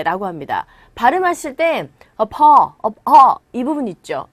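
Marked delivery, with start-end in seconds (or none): 2.2-3.4 stressed "po" in apo, louder; stress on second syllable. Shown as a demonstration. Teacher showing learners where the stress falls, stressed "po". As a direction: punch the "po" of apo. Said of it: "이 부분에 강세 주시면 돼요".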